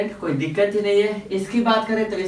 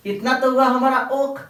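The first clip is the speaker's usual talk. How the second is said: usual speaking voice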